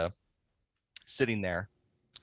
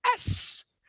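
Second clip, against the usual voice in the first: high, breathless gleeful aside; gleeful whisper; slower than usual. Retold or imitated. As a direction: whisper it in delight.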